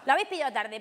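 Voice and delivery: ordinary delivery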